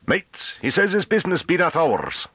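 very high rustic call. A narrator's reading. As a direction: call it out high for all to hear. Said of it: "Rouffrant's voice rose".

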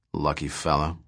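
envious trader, gloomily; gloomy. A narrator's reading. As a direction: mutter it in gloom. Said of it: "gloomed Ponitz".

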